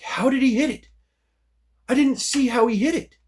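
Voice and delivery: high-pitched, incredulous recreated protest; baffled. Retold or imitated. Fighter puzzled by losing his sword arm, baffled. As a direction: replay total bewilderment, pitch jumping up.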